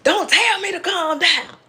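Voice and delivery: high mock snap at the helper; a hint of a furious client; mock outburst. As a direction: play Amy going off. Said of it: "Amy goes the hell off".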